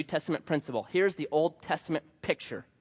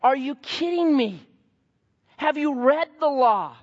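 higher, slow disbelieving challenge; are you serious, louder and higher; incredulous; 9 semitones higher, 5 dB louder than usual. Retold or imitated. Paul challenging the legalists, slow incredulous disbelief. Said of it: "He's saying to these guys".

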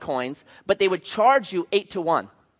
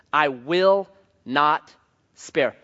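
very slow emphatic; much slower than usual. Acted. Emphatic restatement.

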